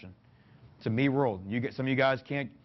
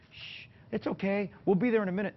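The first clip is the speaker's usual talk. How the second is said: high, soothing mock baby talk; 7 semitones higher than usual. Parent hushing a crying baby, high soothing coo. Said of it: "they're like".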